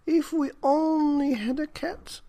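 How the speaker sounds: put-on high, wistful old woman's voice; sighing old woman. A narrator's reading. Old woman sighs to her husband, wishing for a cat. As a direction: sigh it out as a lonely old woman. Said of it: "sighed the very old woman".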